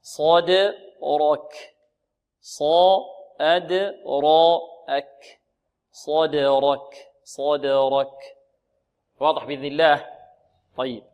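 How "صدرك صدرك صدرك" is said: صدرك very slow, bouncing the د; thick ص and ر, light ك in صدرك; heavy saad and raa. Shown as a demonstration. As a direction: take صدرك apart sound by sound. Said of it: "هكذا".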